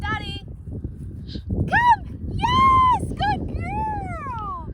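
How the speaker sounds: high, loud call and cheering praise; excited recall. Real, not acted. Trainer reeling her dog in, loud and excited. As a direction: shout come, then cheer.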